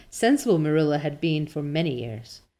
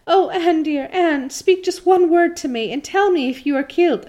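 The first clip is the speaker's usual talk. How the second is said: high, loud, frantic pleading; Diana, shrieking; 10 semitones higher, 5 dB louder than usual. A narrator's reading. Diana begging fallen Anne for one word, acted shriek. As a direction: plead in an acted panic, voice pitched way up. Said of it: "shrieked Diana".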